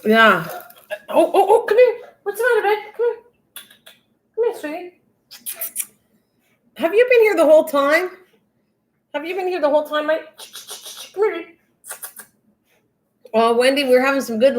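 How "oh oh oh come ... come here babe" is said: high, cooing voice calling the pet out; coaxing pet talk. Real, not acted. Owner coaxing her hiding pet out from the closet, high cooing. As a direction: coo to coax him over, sweetly.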